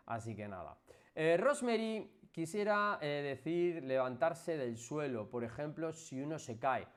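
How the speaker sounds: normal talking voice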